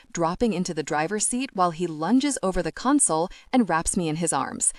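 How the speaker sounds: plain reading voice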